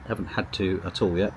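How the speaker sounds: ordinary delivery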